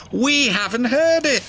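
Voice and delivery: put-on high, childlike coaxing; little engines pleading in high voices; chiming plea. A narrator's reading. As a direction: pipe up eagerly together. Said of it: "they chimed".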